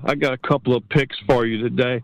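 normal talking voice

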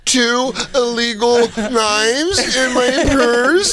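high, slow faux-innocent voice; mock innocent excuse. Mocking her innocent excuse to the cops, high and slow. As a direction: play dumb, drawn out and high.

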